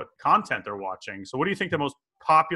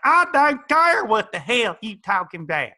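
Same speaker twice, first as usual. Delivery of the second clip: higher, louder, slow sassy voice; 9 semitones higher, 6 dB louder, slower than usual. Retold or imitated. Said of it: "she's like".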